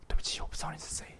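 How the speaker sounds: soft, half-whispered reply; quiet apology. Retold or imitated. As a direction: say it hushed and apologetic. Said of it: "我说". (轻声抱歉地说)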